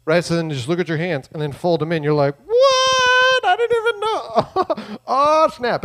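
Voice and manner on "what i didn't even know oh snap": very high, loud, drawn-out squeal of disbelief; hammed-up astonishment, stretched way up high; mock amazement. Person discovering their two hands match, hammed-up high amazement.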